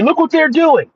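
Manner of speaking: high-pitched imitation of complainers; put-on high voice of protest; mock complaint. Voices what people say in complaint.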